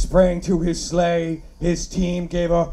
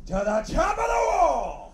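shouted line; 11 semitones higher than usual. Acted. Reading poem, shouting reindeer climb.

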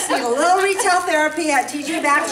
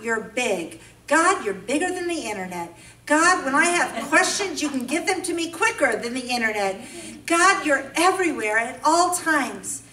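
ordinary talk, nothing marked

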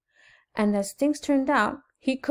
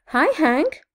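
high, loud friendly greeting; raised, lilting storybook call; 6 semitones higher, 6 dB louder than usual. A narrator's reading. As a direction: call out a bright hello to a neighbor. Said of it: "Rosie the Tumbleweed called out".

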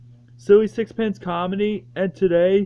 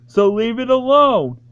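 ordinary delivery

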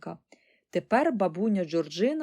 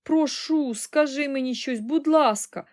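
high, loud worried plea; frantic daughter's raised voice; crying out in alarm; 7 semitones higher, 5 dB louder than usual. A narrator's reading. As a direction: beg mother desperately for an answer. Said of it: "волала пані Бакет".

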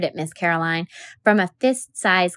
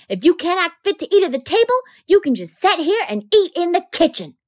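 stern, raised scolding maid's voice; a cook scolding a rude child at the table; 7 semitones higher, 4 dB louder than usual. Acted. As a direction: scold the child hard, pitched up and loud. Calpurnia scolding Scout.

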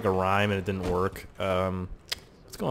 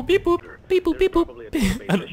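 very high beep-boop noises; mock computer beeps; slower than usual. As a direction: play at being a computer. Pretends to work a computer.

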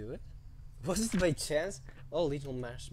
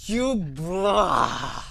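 whimpering cry; 6 semitones higher, 9 dB louder than usual. Real, not acted. Whimpering after scare.

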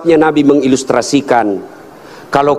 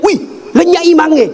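put-on high, startled boy's cry; retold yell; 13 semitones higher than usual. Schoolboy calls out that the imam is gone, mock high yell. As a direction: imitate a boy crying out in alarm. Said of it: "dia teriak".

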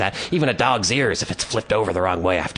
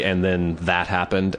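normal talking voice

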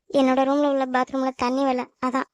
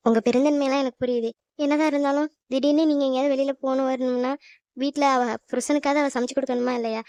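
ordinary talk, nothing marked

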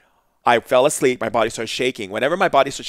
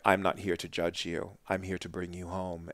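much softer, calm and kind; gentle compassion; 4 semitones lower, 12 dB quieter than usual. Retold or imitated. Shaman answering the two brother spirits, soft and gentle. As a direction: reassure them softly, no judgement.

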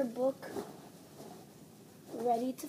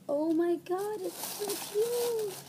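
higher, slow gushing; 7 semitones higher, slower than usual. Real, not acted. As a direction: coo over it. Gushing over the shirt.

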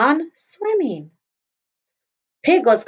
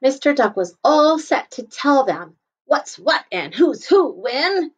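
plain reading voice

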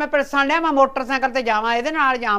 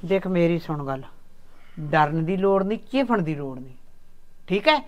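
softer, lower reassuring talk; 4 semitones lower, 6 dB quieter than usual. Acted. Old mother calming her son, softer and lower. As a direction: soothe the son gently.

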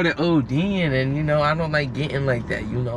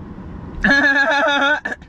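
high laugh; 8 semitones higher than usual. Real, not acted. Laughs after chant.

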